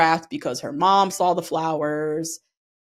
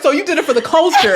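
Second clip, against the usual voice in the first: high, loud quote through laughter; mock-incredulous, laughing as you imitate her; laughing mock confrontation; 9 semitones higher, 7 dB louder, faster than usual. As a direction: retell the challenge fast, cracking up. Retold confrontation, voiced through laughter.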